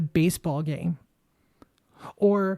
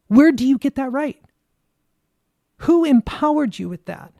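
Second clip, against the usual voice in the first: raised, higher, furious questions; angry shout; 5 semitones higher, 5 dB louder than usual. Real, not acted. Confronting politicians over their duty, shouting angrily. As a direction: demand an answer, loudly.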